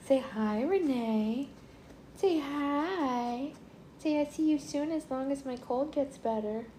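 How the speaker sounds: put-on high, singsong baby-talk greeting; speaking for child. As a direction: coax a little one to say hi, high and lilting. Voicing greeting for child to friend.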